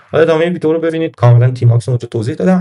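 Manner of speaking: ordinary delivery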